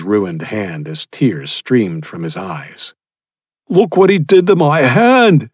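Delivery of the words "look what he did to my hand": high, loud shriek of pain. A narrator's reading. Injured cannibal shrieking over his ruined hand, high and loud. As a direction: wail it, hand ruined, tears streaming. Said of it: "he shrieked".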